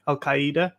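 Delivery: "Al Qaeda" as KAI-da, foreign-leaning; careful accented "Al Qaeda"; Al Qaeda native-style. Shown as a demonstration. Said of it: "is closer to the pronunciation".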